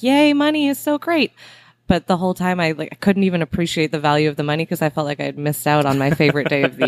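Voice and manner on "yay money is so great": mock cheer. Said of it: "I was like".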